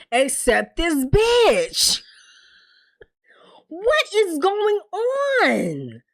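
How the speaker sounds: high, drawn-out cry of disbelief; exasperated outburst. Real, not acted. Viewer baffled by everyone taking the rap, exasperated. Host exasperated at the show's plot.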